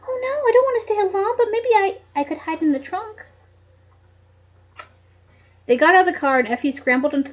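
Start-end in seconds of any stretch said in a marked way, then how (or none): 0.1-3.2 high anxious pleading; plead, frightened; scared. Acted. Trembling Effie pleads.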